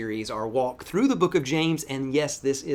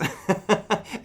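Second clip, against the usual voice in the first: quick high chuckle; short high laugh; 8 semitones higher than usual. Real, not acted. Pastor laughing at his son's question, short high chuckle.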